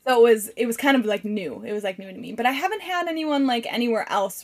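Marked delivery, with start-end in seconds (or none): none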